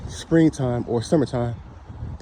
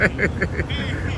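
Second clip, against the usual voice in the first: real quiet laugh, pitched up; soft high laugh; 7 semitones higher, 3 dB quieter than usual. Real, not acted. Vlogger still amused by the jogger, soft laugh. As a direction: let a soft laugh slip out.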